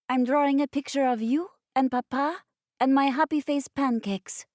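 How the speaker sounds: put-on high, eager little French boy; child delighted with his picture. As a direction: voice a small child proudly showing his drawing. Child Kristoff voiced.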